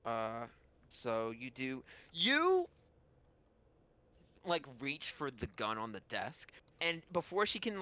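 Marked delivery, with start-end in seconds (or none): none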